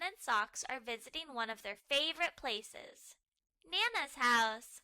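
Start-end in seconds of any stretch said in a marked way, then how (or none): none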